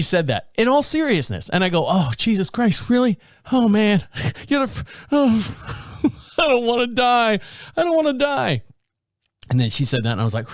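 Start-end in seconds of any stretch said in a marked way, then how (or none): 1.9-8.6 higher, laughing hammed-up dread; mock terror. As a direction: whimper that you don't want to die. Retelling fear of dying.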